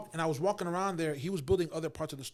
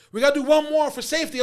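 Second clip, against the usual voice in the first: loud, high imitation of a director; director calling out; 9 semitones higher, 11 dB louder than usual. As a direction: call for another take across the set. Imitates director asking another take.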